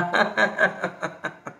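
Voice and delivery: staccato laugh tapering off; laughter exercise. Shown as a demonstration. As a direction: laugh on one breath until it fades. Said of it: "हसत राहा". (विरत जाणारं हास्य)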